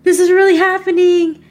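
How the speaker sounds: high, choked-up voice on the edge of tears; tearful disbelief. Retold or imitated. To her guest, retelling the trademark email, near tears. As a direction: relive the moment the happy tears came. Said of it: "I burst into tears".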